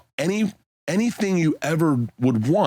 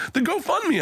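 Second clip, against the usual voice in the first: mock Joe. Bert retells Joe's reply.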